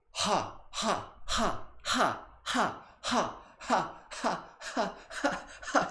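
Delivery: high, breathy ha bursts building into laughter; ha ha on every exhale, high; laughter breathing. Shown as a demonstration. Leading the class in laughter meditation, high ha bursts. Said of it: "Follow my lead. Focus on the exhale".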